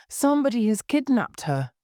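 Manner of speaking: high, urgent exclamation at moderate volume; narrated shout. A narrator's reading. Girl reports a kidnapping to police, shouting mildly. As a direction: raise the pitch in mild mock shout. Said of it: "shouted Amy".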